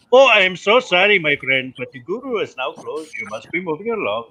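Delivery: lightly voiced Indian shopkeeper accent; a touch of a polite Indian guru; mock Indian accent. Brushing off annoying questions at sister's job in accent. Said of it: "the one that I gave to my sister".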